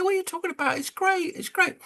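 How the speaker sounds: very high, gushing falsetto; mock gushing crowd. Crowd dismissing a bearish call, squeaky mock gush. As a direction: squeal it like giddy fans. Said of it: "they say".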